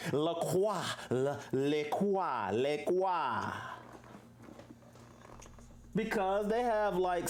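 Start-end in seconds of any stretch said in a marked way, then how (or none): 0.1-0.8 "La Croix" said "la kwa", silent X; correct French La Croix. Shown as a demonstration. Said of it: "The I and the X is silent". 1.1-3.5 "Le Croix" said "le kwa", slowly; Le Croix variant. Shown as a demonstration. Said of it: "trying French variant".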